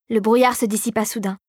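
usual speaking voice

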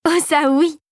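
bright, high burst of yes; exclaiming. A narrator's reading. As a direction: jump up in eager agreement. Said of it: "s'exclama Marie".

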